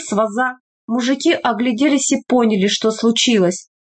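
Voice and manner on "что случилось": ordinary delivery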